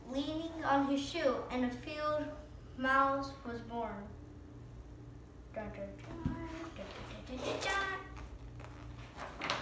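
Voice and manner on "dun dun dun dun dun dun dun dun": spooky dun dun dun drum sound. Pretend. Dramatic sound effect in story.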